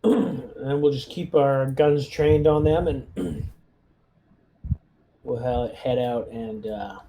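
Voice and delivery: usual speaking voice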